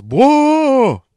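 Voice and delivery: hammed-up startled shout; Hank's yell. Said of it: "Hank yells".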